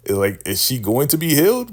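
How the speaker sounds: ordinary delivery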